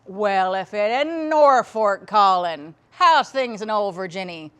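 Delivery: imitating a loud, hearty dad on the phone; dad's greeting. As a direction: draw out the opening, then greet loudly. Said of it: "Or later he would say".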